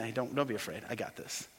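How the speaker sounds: very soft, low comforting voice; hushed, gentle promise that all is well; tender reassurance as Jesus. Acted. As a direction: soothe someone afraid of dying. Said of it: "Jesus would say to you".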